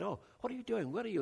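imitating his own surprised phone greeting; startled delight at an old friend calling. Retold surprised call to old friend.

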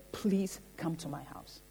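very hushed, low, imploring voice; humble, reverent plea. Retold or imitated. Retelling Jairus begging Jesus before the crowd, hushed reverent plea. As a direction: drop almost to a murmur, pleading humbly. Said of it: "He came with great humility and reverence".

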